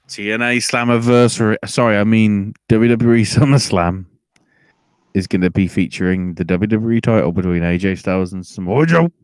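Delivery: loud, excited match announcer; pay-per-view hype voice; hype announcement. Acted. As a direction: sell the main event loud. Hyping the title match.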